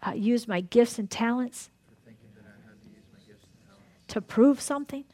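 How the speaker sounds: earnest prayer. Acted. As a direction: pray it out for the whole room. Leads a group prayer.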